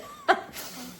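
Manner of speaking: breathy squealing giggle; high giggle. Real, not acted. Vlogger amused by her memory, giggling. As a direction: let a high laugh escape.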